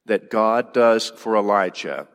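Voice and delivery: ordinary talk, nothing marked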